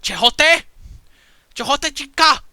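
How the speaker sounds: high, loud call from far off; distant call. Acted. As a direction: shout it across the village. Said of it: "hear this voice call out from the village".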